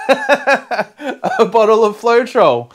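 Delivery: laughing it out slowly; delighted laughing reveal. Real, not acted. Laughs over gift.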